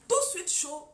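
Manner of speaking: preacher acting out a quick temper, loud; loud burst. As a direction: flare up on hot. Hot and cold contrast.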